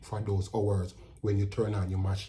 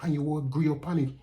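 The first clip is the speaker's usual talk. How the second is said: ordinary talk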